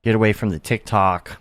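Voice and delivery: ordinary talk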